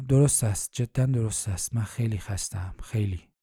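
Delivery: tired groan, fading away; weary moan. A narrator's reading. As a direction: voice sinking low and soft. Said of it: "سری تکان داد و نالید".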